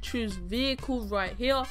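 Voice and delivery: normal talking voice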